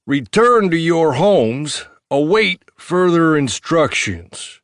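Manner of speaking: slow, hammered-out order; slow commanding officer. Acted. Cop repeating his order to Marv, slow and firm. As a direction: drag out every word like a command.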